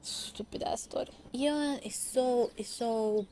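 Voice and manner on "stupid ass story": soft unvoiced grumble; muttered aside. Real, not acted. Vlogger mocking the drama plot, muttered. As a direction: toss it off under your breath.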